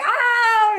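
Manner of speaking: high, loud imitated wail of a child breaking into tears; crying cry out. Nephew punching feed bag bursts into tears, imitated high loud wail. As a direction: let out a sudden high crying yell as the punches turn to tears. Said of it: "and he's crying".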